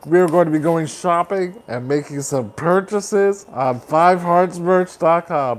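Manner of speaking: usual speaking voice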